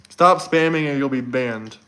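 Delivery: ordinary delivery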